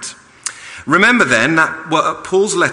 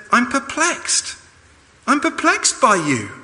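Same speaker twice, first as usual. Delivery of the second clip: Paul perplexed; 11 semitones higher than usual. Retold or imitated. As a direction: linger on perplexed in disbelief. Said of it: "he now says".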